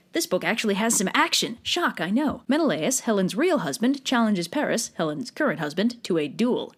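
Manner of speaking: ordinary talk, nothing marked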